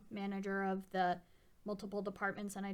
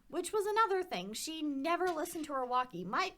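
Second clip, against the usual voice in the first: ordinary talk, nothing marked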